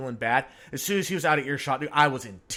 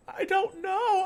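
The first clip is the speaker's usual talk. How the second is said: put-on very high small-child voice; child's reply; slower than usual. Daughter answering her father, high childish voice. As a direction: answer like a little girl. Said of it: "She's like".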